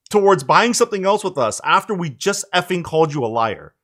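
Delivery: ordinary talk, nothing marked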